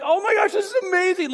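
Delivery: high, fast, loud thrilled cry; giddy shout. Retold or imitated. Boys in the fire, shouting with delight. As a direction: shout it like a kid who can't sit still. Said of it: "You're like".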